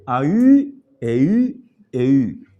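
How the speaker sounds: a u, e u ending in a French-style ü; avoided diphthong sound. Shown as a demonstration. Teacher shows students the sound not to use, ü-ending diphthongs. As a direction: round the final u of a u, e u into ü. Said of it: "En vez de".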